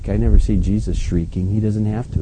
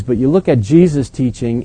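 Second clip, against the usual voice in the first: normal talking voice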